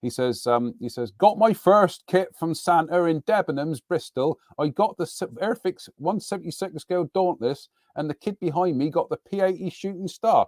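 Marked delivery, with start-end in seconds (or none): 1.1-10.5 higher, mock voice for a viewer's comment; Rob impression. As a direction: read it in a jokey put-on voice, higher. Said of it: "'cause that's what Rob sounds like".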